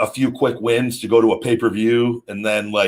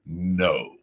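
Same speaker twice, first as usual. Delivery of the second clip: low, softer, final no; deadpan refusal; 5 semitones lower, 6 dB quieter than usual. Retold or imitated. Answering people who keep asking, deadpan refusal. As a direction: shut the question down in a lowered voice. Curt answer to mimicked question.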